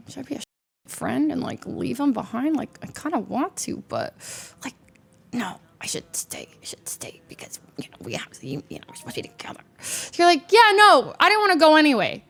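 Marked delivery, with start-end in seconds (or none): 0.1-9.6 very soft, sinking into a whisper; hushed deliberation. Retold or imitated. Acted hesitant inner debate. 10.5-12.2 higher, quick acted reassurance to a friend; mock breezy brush-off.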